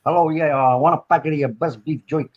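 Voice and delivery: put-on New York accent, placing an order. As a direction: put on a New York customer, lightly amused. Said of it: "New York voice and go and buy".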